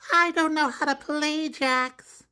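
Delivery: put-on high, slow kid's voice; high girl's voice; little girl. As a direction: admit you don't know the game. Molly voiced.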